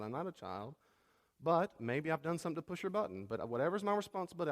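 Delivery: ordinary talk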